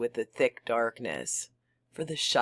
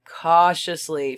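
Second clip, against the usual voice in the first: sudden loud emphasis; 3 semitones higher, 8 dB louder than usual. Acted. Repeated word suddenly hissed loud.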